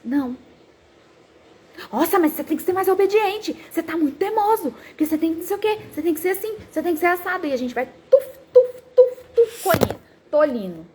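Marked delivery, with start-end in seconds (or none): 1.9-7.5 higher, mock-stern parent nagging; lecturing parent. Parent lecturing a stubborn child. 8.1-10.0 loud, high imitated thuds; mock thumping sounds. Imitated blows.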